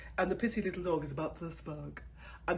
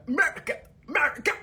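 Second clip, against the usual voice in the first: much higher, louder sing-song America; mock excitement; 11 semitones higher, 9 dB louder, faster than usual. Mother mocking her love of American shopping, in a high loud cheer.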